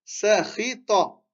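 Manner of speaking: Sakhita stretched out, syllable by syllable; teacher modelling the word slowly; slow careful reading. Shown as a demonstration.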